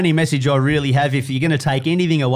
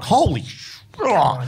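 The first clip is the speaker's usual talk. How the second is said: high mock shock, cut off mid-curse; a retold shocked blurt; stunned exclamation; 6 semitones higher, slower than usual. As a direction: gasp it out in disbelief. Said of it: "I was just like".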